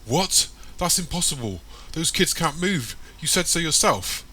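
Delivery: high-pitched, incredulous protest; surprised and worried. A narrator's reading. Tara can't believe the kids have gone, surprised and worried. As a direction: react in worried disbelief. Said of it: "an expression of surprise and worry".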